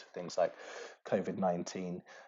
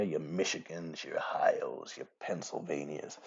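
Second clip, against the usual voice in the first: a lightly put-on American accent on the state names; mock American accent, half-hearted and dropping to a murmur; bad American accent; 4 semitones lower, 5 dB quieter, slower than usual. Lecturer listing Rust Belt states in a weak American accent. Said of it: "that's bad American accent".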